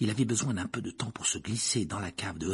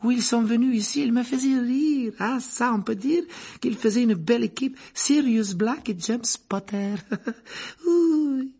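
barmaid voice; 14 semitones higher, 4 dB louder than usual. A narrator's reading. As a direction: lift the voice high and serve the drinks sweetly. Rosmerta fondly remembers the boys.

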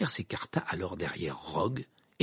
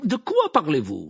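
raised, high, demanding voice; impatient teacher voice; scornful question; 5 semitones higher, 7 dB louder than usual. A narrator's reading. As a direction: demand an explanation loudly. Snape scornfully questions Harry.

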